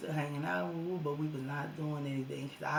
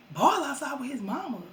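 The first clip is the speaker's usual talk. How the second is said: high, louder disbelieving echo; shocked disbelief; 9 semitones higher, 7 dB louder than usual. Retold or imitated. To her sister about the boy at the door, high shocked disbelief. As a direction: repeat it in alarm.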